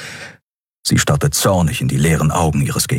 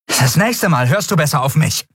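angry warning; 8 semitones higher than usual. A narrator's reading. Andriel warns after striking him.

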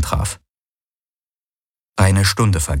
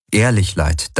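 ordinary delivery, nothing marked

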